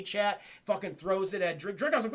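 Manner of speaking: ordinary talk, nothing marked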